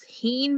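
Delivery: 'heen' with 'ee' in place of 'eh'; long E mispronunciation. Shown as a demonstration. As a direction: say 'heen' to rhyme with 'seen'. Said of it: "Which one makes sense".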